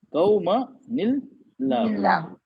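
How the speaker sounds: कौमनिल्लाहु stretched out slowly; slow recitation demo. Shown as a demonstration. Teacher recites slowly to show the joining. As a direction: draw out कौमनिल्लाहु syllable by syllable. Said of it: "teacher demonstrates recitation".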